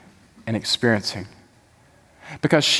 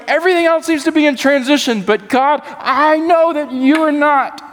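much higher, fervent preaching cry; impassioned psalmist; 8 semitones higher than usual. Retold or imitated. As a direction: psalmist crying out his trust at full force. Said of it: "And he's going".

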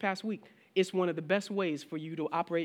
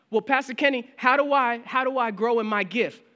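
higher, louder asking church member; eager congregant; 7 semitones higher, 9 dB louder than usual. Retold or imitated. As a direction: play the keen believer asking the pastor. Pastor voices an asking congregant.

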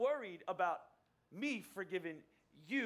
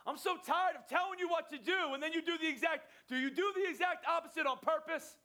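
much higher, louder scolding yell; imagined God shouting at a disobedient child; exasperated shout; 9 semitones higher, 4 dB louder than usual. Retold or imitated. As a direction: blow up at a kid who never listens. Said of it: "It's God speaking to me or you".

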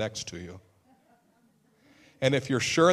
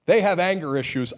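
mock accusation; 7 semitones higher, 7 dB louder than usual. Mocking self-justifying accuser.

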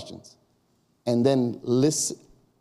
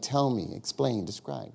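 each word quieter, "describe" almost whispered; softly fading; 4 semitones lower, 8 dB quieter than usual. Shown as a demonstration. Listing the three questions, fading softly. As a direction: let it trail to nothing.

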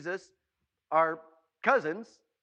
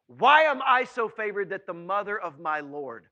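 loud, wondering cry; forceful exclamation; 3 semitones higher, 10 dB louder than usual. Acted. Preacher acting Elizabeth's amazement at Mary's visit, loud exclamation. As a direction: burst out loudly, then let it fall.

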